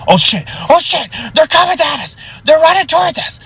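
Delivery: very high, strained alarm; panicking. Retold or imitated. Old man warning that demons are coming, high-pitched panic. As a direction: imitate terrified cries of warning. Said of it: "freaking out".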